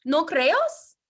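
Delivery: usual speaking voice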